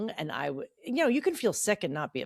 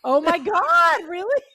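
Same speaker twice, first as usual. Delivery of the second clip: surprised; 10 semitones higher, 8 dB louder, slower than usual. Real, not acted. Sister recalls the wrong birthday.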